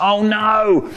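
dismayed. A narrator's reading. Narrator's dismayed exclamation.

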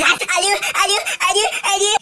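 high, whiny mock cry of pain; fake crying. Tattletale schoolgirl fake-crying to the teacher. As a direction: overplay the hurt for the teacher.

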